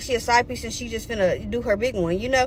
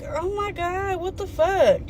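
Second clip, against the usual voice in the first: higher, hushed mock shock; stunned disbelief; 5 semitones higher, 6 dB quieter, slower than usual. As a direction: gasp it softly to yourself, appalled. Retold shocked aside at the chaos.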